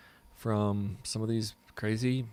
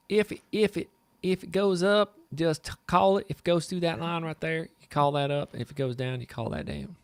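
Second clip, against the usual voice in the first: high, loud Tennessee accent easing down to normal pitch; put-on Tennessee accent; 7 semitones higher, 4 dB louder than usual. Shown as a demonstration. Trader showing off his own accent, in Tennessee dialect. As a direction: do your home-state Tennessee accent, starting high and strong. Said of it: "Here's my accent".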